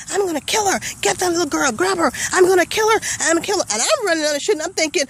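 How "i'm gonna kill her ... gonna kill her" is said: high, strained mock scream of a threat; mother screaming after the fleeing girl, high retold yell. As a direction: yell it like a raging mother chasing you. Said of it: "and she was screaming".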